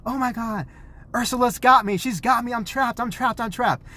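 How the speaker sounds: high-pitched pretend distress; mock panic of kids. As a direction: play trapped kids squealing for rescue. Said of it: "the kids were like".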